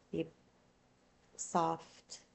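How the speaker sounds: normal talking voice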